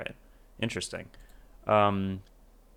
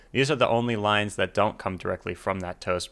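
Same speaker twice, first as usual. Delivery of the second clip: ordinary delivery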